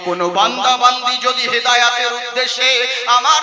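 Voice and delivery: loud, fiery sermon rebuttal; preaching at full force. Acted. Preacher rebuking the guardian before the crowd, fiery and loud. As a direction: thunder the answer at the excuse.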